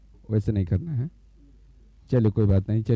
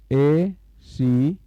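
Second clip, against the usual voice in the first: ACB stretched very slowly with a rise; drawn-out dictation; much slower than usual. Shown as a demonstration. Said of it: "तो कोण". (ACB को बहुत धीरे, खींचकर बोलना)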